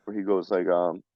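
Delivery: ordinary talk, nothing marked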